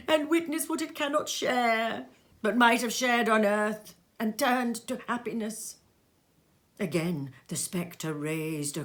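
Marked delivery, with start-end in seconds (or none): 0.1-5.8 high, loud mournful ghost voice; lamenting ghost. Acted. Ghost telling Scrooge its fate, high loud lament. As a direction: wail out your doom.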